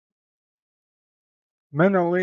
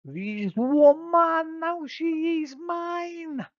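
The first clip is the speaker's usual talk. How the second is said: put-on much higher slow crowing Spanish accent; triumphant crowing; slower than usual. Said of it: "Paris crows in triumph".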